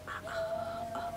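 very soft, high cooing sounds; soft high coos. Real, not acted. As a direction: coo softly and high. (bebeğe çok kısık, ince agucuk)

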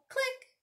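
high, quick clicking sound; little high-pitched camera click. A narrator's reading. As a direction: chirp a tiny shutter click.

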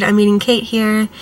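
ordinary talk

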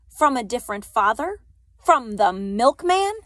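loud, rising mocking questions; taunting insinuation. A narrator's reading. Officer taunting the parents.

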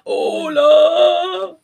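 hollow ghostly call; voice from beyond the grave. Acted. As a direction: moan it like a ghost from the tomb. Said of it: "la voz de Ultratumba".

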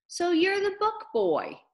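high-pitched, knowing greeting; dawning recognition. Acted. Missus Beale recognises Jeffrey, dawning recognition. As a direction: greet him as the one you've heard about. Mother greets boy she heard of.

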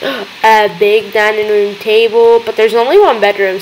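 ordinary delivery

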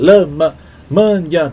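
"Lama" and "manhã" lifted and punched apart; syllables stressed. Shown as a demonstration. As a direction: hit "Lama", then "manhã", as rhyming syllables. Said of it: "Quebrar as palavras em sílabas". (destaque "Lama, manhã" sílaba por sílaba)

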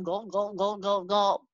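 mimicked gulps in a quick run; imitated gulping. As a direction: gulp rhythmically like a drinking dog. Imitates drinking head down from brook.

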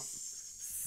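sustained "S", air only, soft; voiceless S. Shown as a demonstration. Teacher shows students nothing vibrates, sustained S. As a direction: hold a soft "S" without vocal cords. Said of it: "Là, ça ne vibre pas".

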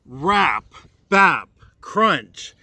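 ordinary delivery

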